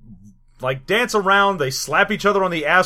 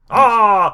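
drawn-out dismayed "ah"; acted wail of bad news; mock anguished yell; 3 semitones higher, 5 dB louder than usual. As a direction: cry out in hammed-up anguish. Acted reaction to bad prophecy.

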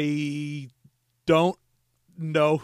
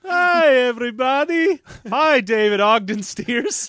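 Dr. Nick impression; 5 semitones higher, 4 dB louder than usual. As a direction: chirp hello like a sleazy cartoon doctor. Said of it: "that crappy doctor from The Simpsons".

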